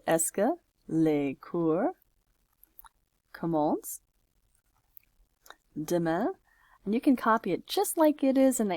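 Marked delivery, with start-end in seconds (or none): 0.1-6.3 slow French question with long pauses between words; spaced out as if typing along; word-by-word dictation. Shown as a demonstration. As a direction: dictate it slowly, piece by piece.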